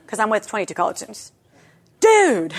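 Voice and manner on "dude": high, louder mock amazement; amazed. Twenty-two college students reacting, imitated loud amazement. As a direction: exclaim like an awed student, pitched up and punched.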